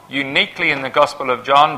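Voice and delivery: ordinary delivery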